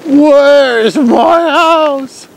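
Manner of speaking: very slow, high mock wail; hammed-up despair. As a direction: stretch it out like lost.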